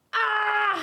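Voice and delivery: high loud cry for the drawn man; cartoon shock scream; drawn man's scream. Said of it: "Et là, il crie".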